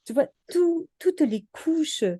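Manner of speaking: wonder. Real, not acted. Marvelling at the layered anatomy pages. (émerveillée, voix qui monte très haut et ralentit)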